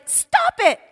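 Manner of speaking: put-on high, childlike shout; a tiny girl shouting at big boys; little girl yelling. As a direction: yell like a bold three-year-old.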